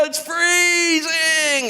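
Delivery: high, slow shout at the chill; shocked cold swimmer. Retold or imitated. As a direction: drag it out like the cold just hit your chest. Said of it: "they go a bit deeper".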